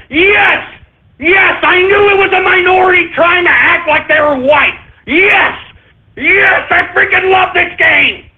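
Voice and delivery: high, shouted yes, yes; gloating triumphant shouting, pitched well up; triumphant excitement. Real, not acted. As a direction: cheer like you just won the game. Host celebrates his correct guess.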